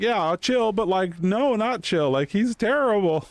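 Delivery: high, sarcastic mock comeback; sarcastic retort. Refusing to chill about the bad DPS, sarcastic and high. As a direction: play up the scorn for the winner. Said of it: "And it's like".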